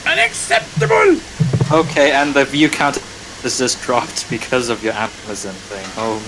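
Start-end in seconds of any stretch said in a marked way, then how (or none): 0.0-1.2 very high squeaky mock indignation; high mock outrage. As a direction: parody his outrage. Mocking the previous line.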